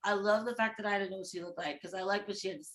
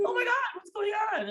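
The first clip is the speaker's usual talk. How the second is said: fast, higher startled diner; startled neighbour; 11 semitones higher, much faster than usual. Retold or imitated. The table next to them panics, fast and high. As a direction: gasp it out quickly and high.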